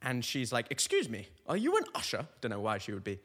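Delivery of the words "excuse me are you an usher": British lady's high-rising polite question; lightly hammed-up British woman approaching a stranger. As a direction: ask it like an eager British lady. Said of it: "she's British in this story".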